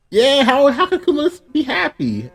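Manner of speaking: incredulous question. Real, not acted. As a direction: ask in high disbelief. Dismayed reaction to the story.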